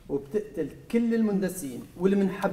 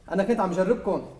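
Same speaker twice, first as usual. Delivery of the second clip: ordinary talk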